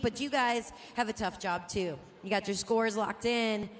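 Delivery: usual speaking voice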